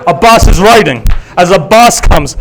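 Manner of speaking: urgent retelling, building in pitch to full voice; impassioned sermon narration. Acted. Preacher telling of Abbas riding to Hur, urgent and building.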